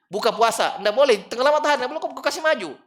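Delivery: high and loud, urging the crowd; impassioned preaching. Acted. Preacher telling mourners to bury fast and move on, loud and high. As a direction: press the point hard from the pulpit. Preacher lists what not to hasten.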